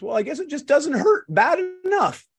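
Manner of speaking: high hammed-up old gentleman, deadpan; old man voice.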